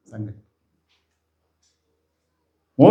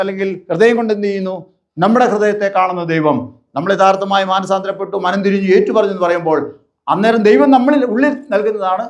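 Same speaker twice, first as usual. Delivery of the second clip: raised, fervent pulpit voice, hammering each line; preaching at full force; 6 semitones higher than usual. Acted. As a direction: drive the repeated warnings home to the congregation. (ആവേശത്തോടെയുള്ള പ്രസംഗം)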